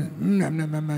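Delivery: low, soft imitated mumbling; muttering. Swami arrives muttering something, low indistinct mumble. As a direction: mumble wordlessly under the breath. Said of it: "he is muttering something".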